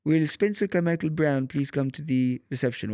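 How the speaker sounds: put-on lightly done French tannoy accent. Imitating the French airport tannoy calling his name, light French accent. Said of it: "obviously the French accent".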